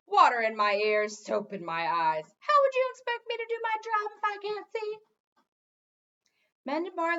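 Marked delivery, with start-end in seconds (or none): 0.1-5.0 louder, aggrieved complaining; loud gargoyle rant. A narrator's reading. Gargoyle ranting at the king, loud grievance. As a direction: rant about the soap in his eyes.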